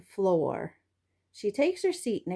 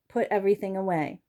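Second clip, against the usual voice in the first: ordinary delivery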